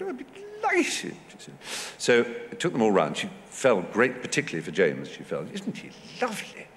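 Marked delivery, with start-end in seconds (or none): 0.0-1.2 delighted duchess. Retold or imitated. Duchess agreeing to receive the actors, imitated as delighted. Voices the Duchess accepting his request. 5.5-6.7 high, hushed society-lady swoon; smitten duchess. Retold or imitated. Duchess taken with young actor James, imitated admiringly.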